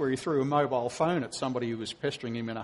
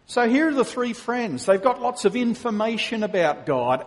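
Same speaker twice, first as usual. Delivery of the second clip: usual speaking voice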